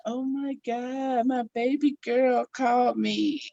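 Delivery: high, drawn-out, softened imitation of an emotional dad; overjoyed father. Father hearing his daughter call at last, imitated high and overcome. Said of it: "And then he was like".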